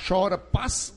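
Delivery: ordinary talk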